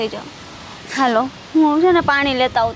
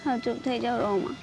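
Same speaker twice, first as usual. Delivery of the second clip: much softer, lower hush; soft soothing; 5 semitones lower, 10 dB quieter than usual. Acted. Daughter gently soothing her weeping mother, soft. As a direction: ease her into calm.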